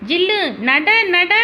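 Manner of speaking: high, eager urging to a pet; dog command. Acted. Boy making his puppy walk, high eager command. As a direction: urge the puppy on in a high child's voice.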